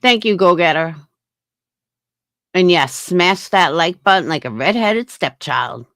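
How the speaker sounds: ordinary delivery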